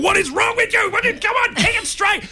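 very high, strained mock scream; exasperated fan's high-pitched yelling; screaming at players. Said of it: "I'm there screaming".

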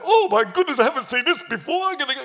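high, loud mock shock; hammed-up flustered overreaction; blowing his top. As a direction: burst out in sudden outrage. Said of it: "He wasn't blowing his top".

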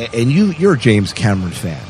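ordinary talk, nothing marked

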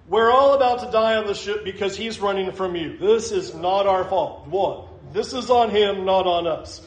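ordinary delivery

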